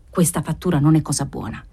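ordinary delivery

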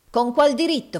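loud, high challenge; indignant demand. A narrator's reading. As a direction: demand it heatedly. Villain demands the hero's right.

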